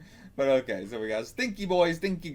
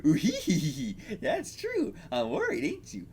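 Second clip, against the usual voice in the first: high hee-hee cartoon giggle-talk; titter, then squeak the line out high; tittering character voice; 8 semitones higher than usual. One beggar answering another, high tittering voice.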